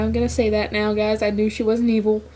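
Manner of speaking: usual speaking voice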